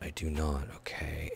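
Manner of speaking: muttered softly under breath; whispered aside. Real, not acted. Gamer confessing he forgot how, whispering. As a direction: drop to a whisper, admitting it to himself.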